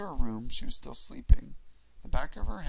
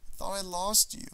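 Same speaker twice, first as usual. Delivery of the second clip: tearful; 6 semitones higher than usual. Acted. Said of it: "tears streaming down my face".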